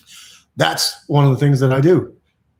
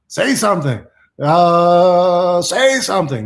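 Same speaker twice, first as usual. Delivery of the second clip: playful announcement; 3 semitones higher, 5 dB louder, slower than usual. Pretend. Hammed-up reading of a phrase.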